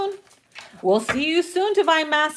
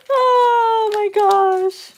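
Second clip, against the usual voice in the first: a very slow, stretched oh my gosh, a little higher; long, dragged-out oh my gosh; drawn-out exclamation; 8 semitones higher, 4 dB louder, much slower than usual. Real, not acted. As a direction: stretch it out in amused disbelief.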